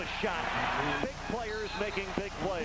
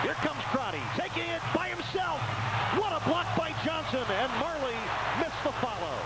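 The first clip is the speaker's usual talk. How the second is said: higher, quicker play-by-play; rising excitement; 7 semitones higher than usual. Real, not acted. Commentator calling a block on the break, excited. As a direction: ride the fast break with the voice.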